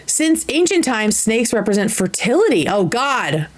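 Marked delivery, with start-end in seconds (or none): none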